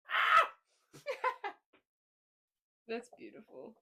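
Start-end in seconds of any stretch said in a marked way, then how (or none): 0.1-0.5 short shout. Acted. Spooky sign-off shriek.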